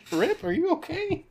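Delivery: high, startled, laughing question; stunned disbelief. Retold or imitated. Host reacting to Rip's odd line, high stunned disbelief. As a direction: squeak out baffled concern. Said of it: "And I'm just like".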